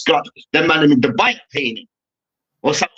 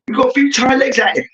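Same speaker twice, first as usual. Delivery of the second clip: higher, incredulous repeat of the excuse; mocking disbelief; 6 semitones higher than usual. Fan mocking the captain's excuse, incredulous and higher. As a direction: throw his words back at him in disbelief. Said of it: "You really come up there and said".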